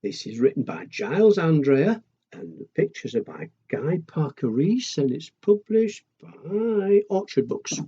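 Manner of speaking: ordinary delivery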